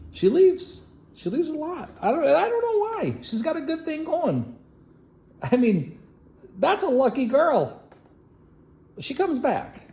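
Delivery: very high, wounded protest; mock bewildered complaint. Mock complaint about her leaving.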